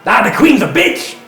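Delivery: high, loud jeering yell; townsperson heckling. Acted. Game master as a townsperson answering the crowd, heckling yell. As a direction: shout back over the crowd. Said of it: "Other voices elsewhere".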